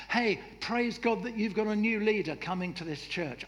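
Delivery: ordinary delivery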